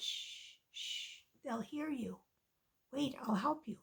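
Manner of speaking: soft, secretive shushing; hushing mouse. Acted. Little mouse to lion so hunters won't hear, hushing. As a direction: keep it down so nobody hears.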